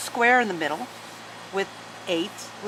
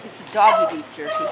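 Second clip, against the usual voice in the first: much higher sing-song pet talk to the dog. Real, not acted. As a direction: coo it at the dog like a treat is coming. Talking to the dog.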